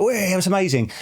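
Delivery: playful impression of an excited child; mock excited son. Voicing his thrilled young son.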